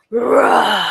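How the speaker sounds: long rolled play roar; drawn-out storybook dragon bellow. Acted. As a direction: roar like a valiant little dragon. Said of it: "Hear my courage as I bellow".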